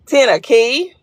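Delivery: Tennessee said as "ten-a-key"; local Memphis pronunciation. Retold or imitated. Teasing Memphis viewers about their saying, local pronunciation. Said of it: "Ain't that what y'all say?".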